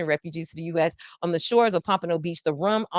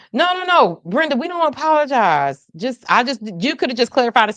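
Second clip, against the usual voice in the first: exasperated; 6 semitones higher, 6 dB louder than usual. Real, not acted. Addressing Brenda, exasperated correction.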